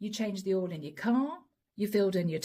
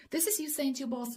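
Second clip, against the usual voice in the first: ordinary talk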